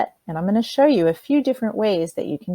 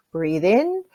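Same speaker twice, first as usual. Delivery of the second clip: guided breathing cue. Shown as a demonstration. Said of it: "you're gonna breathe in".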